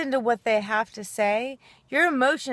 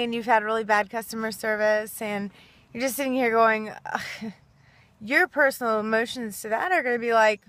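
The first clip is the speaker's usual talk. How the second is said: ordinary talk, nothing marked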